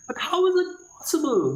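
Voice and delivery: put-on high, bright child's question; puzzled child. Catechism child asking the teacher about the Trinity, puzzled. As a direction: ask it like a confused little child.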